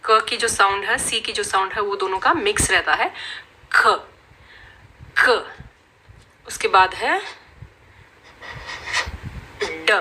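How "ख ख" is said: ख from the throat, short and breathy; phonics c sound. Shown as a demonstration. Teacher demonstrating the c sound, throaty ख. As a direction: let ख come from the throat with air. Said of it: "उसमें थोड़ी सी ख की आवाज आती है".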